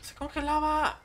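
high, rising frantic cries; panicking gamer. Real, not acted. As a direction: yelp in a panic as the boss closes in. Boss fight chaos.

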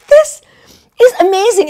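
high, loud burst of excitement; excited. Retold or imitated. Reacting to the phone stuck again, thrilled and loud. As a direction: let the first word leap up in delight.

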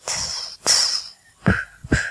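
voiceless hisses and puffs; puffing and blowing. A narrator's reading. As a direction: blow at fluff in short puffs. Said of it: "soffiando sulla lanugine dei fiori".

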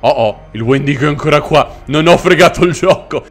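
normal talking voice

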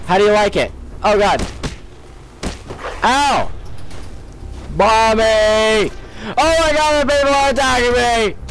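high, frantic begging for mercy; panicked pleading. Real, not acted. Begging during the dog attack.

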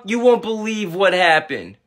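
ordinary talk, nothing marked

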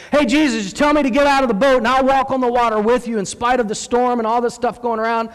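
high, bold run-on request; eager calling out. Acted. Peter calling to Jesus from the boat, eager. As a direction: pitch it up like an overeager volunteer.

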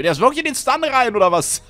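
high, loud laughing question; laughing disbelief. Real, not acted. Gamer laughing at an enemy running into the stun, high and amused.

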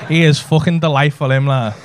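ordinary talk

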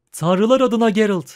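high, loud, winded exclamation; out of breath. A narrator's reading. Dandelion climbing the steep bank to Geralt, out of breath. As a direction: exclaim it while panting from a climb. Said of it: "dedi Dandelion soluk soluğa".

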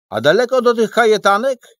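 a tiny figure asking eagerly; high character voice. Acted. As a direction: squeak it out like a little creature. Niedolistek's character question.

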